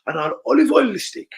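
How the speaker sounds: high, loud hammed-up chef commands; mock chef. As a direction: play a ranting TV chef barking steps. Mock chef cooking demo voice.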